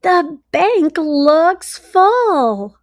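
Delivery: bright, expressive storybook read-aloud; reading to children. A narrator's reading. Teacher reading a story to her class, expressive read-aloud. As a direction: read it like a teacher sharing a picture book.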